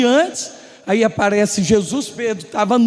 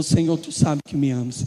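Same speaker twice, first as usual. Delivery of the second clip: low, soft, hurt reply; sad Peter; 8 semitones lower, 5 dB quieter than usual. Acted. Peter, saddened, answering Jesus in a low voice. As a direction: answer with a hint of sadness, quietly. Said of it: "ele se ficou triste".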